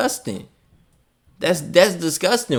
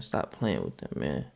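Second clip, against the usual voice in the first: much lower and softer, under the breath; mumbled low aside; 13 semitones lower, 13 dB quieter than usual. Real, not acted. Talking to the chef commenter, low muttered aside. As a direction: mutter it to yourself.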